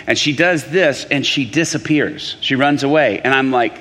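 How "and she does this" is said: ordinary talk, nothing marked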